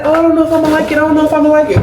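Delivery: high-pitched mocking imitation of a boyfriend; mock-doubtful voice, held high; imitating doubtful boyfriend. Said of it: "Because he keeps saying".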